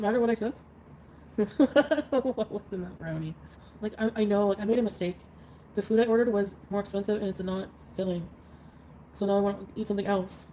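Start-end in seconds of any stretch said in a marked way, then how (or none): none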